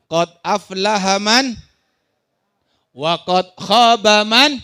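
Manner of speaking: slow, measured sacred recitation; Quran recitation. Acted. Preacher recites a Quran verse to congregation, slow recitation. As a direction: draw out each long vowel, swelling in volume.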